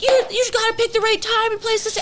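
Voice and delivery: high, strained crying yell; crying scream. Retold or imitated. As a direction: yell it high through tears. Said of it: "start crying and screaming".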